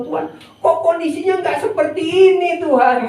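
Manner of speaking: high-pitched mock protest to God; lightly acted grievance; mock complaint. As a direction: whine upward as if questioning God.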